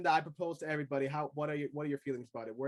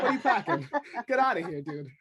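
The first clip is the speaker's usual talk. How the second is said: mock disbelief; 3 semitones higher, 4 dB louder than usual. Guy scoffing at a movie hero's impossible move, louder. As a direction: call out the bluff, loud and teasing. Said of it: "It's like".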